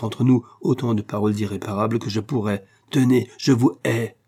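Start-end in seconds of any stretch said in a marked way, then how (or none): 2.9-4.2 slow, loud and higher, spitting out hatred; wife declares hatred to husband, slow and loud; hoarse hatred. A narrator's reading. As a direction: let each word of hate land slowly. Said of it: "d'une voix rauque".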